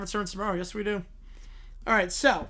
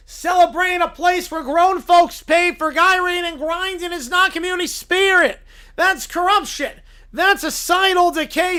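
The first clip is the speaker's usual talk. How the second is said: loud, pompous Southern lawyer voice; 5 semitones higher, 5 dB louder than usual. Acted. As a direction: preach moral outrage to the judge like a Southern showman. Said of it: "Southern blue-haired lawyer".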